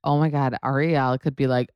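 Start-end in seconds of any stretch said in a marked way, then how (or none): none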